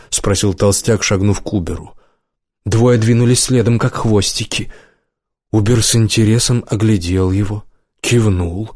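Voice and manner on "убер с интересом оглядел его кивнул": ordinary delivery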